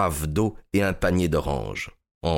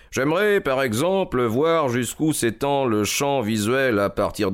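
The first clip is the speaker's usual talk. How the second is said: higher, courteous detective voice; Holmes, politely insistent; 4 semitones higher than usual. Acted. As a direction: ask with unhurried, polite insistence. (détective poli et insistant, voix plus haute)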